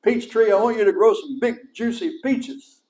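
calling out blessings over the fruit trees. Retold or imitated. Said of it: "I started telling those, that plum tree".

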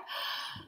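short pretend gasp of delight; excited gasp. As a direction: gasp at the good news. Reacting to the winner.

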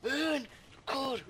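very high mock yell; high-pitched, hammed-up holler; imitated loud shout. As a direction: shriek it like the retold guy. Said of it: "dar ăla strigă tare".

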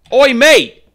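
high, bellowed mock-British; very loud fake British holler; bad British accent. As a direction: yell it like a hammed-up Brit. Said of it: "do a British accent this badly".

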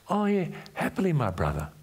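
a hint of a bright, willing sister; high, lightly voiced eager reply; eager Tamar. Preacher voicing Tamar agreeing to help, lightly eager.

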